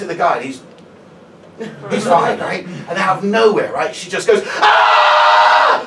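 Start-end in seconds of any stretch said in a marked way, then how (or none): 4.6-5.8 piercing full-volume shriek; screaming. Retold or imitated. Drunk woman screaming accusations out of nowhere, full-throated shriek. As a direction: scream it wildly, high and very loud.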